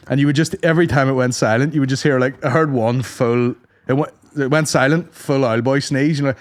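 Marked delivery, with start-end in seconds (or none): none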